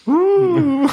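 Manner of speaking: loud high plane whoosh; airplane noise. Pretend. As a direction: zoom like a toy plane. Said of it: "Du hast mein Flugzeuggeräusch verpasst". (lautes Flugzeuggeräusch, hoch)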